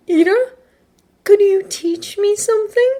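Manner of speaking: high, airy character voice with a lift; playful come-on. Acted. Midoriya teasing Tenya in his fantasy, playful high come-on. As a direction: pitch it way up, coyly asking.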